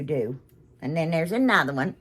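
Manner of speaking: usual speaking voice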